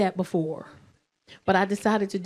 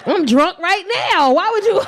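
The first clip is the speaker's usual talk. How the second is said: put-on laughing drunk protest; 7 semitones higher, 5 dB louder than usual. Tipsy mom retorting, laughing.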